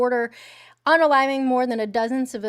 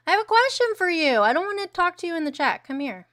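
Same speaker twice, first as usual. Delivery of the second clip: playful summons; 7 semitones higher, faster than usual. Real, not acted. Calling viewer up to stream.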